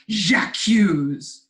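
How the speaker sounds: loud, theatrical vegetable accusation; taunting. Acted. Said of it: "I can hear the asparagus taunting".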